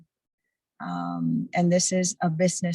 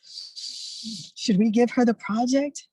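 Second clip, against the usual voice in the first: mock doubtful colleague; 4 semitones higher, much slower than usual. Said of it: "so I cry and you're like".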